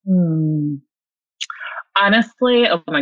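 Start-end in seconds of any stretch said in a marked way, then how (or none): none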